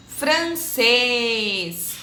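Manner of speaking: francês with a long final CÊS; stressed syllable stretched. Shown as a demonstration. Teacher modelling circumflex words, francês with CÊS drawn out. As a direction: hold the closed ê in francês. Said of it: "a sílaba mais forte".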